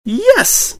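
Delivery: high, loud chirp; chirped yes. A narrator's reading. Pinkie cheers for the cannon story, high chirp. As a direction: squeal it out. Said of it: "Pinkie chirped".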